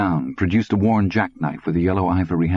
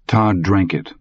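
ordinary delivery

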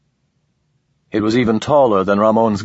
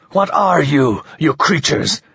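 angry; 6 semitones higher than usual. Acted. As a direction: confront them in rising rage. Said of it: "Ramon's rage ... Now it returned".

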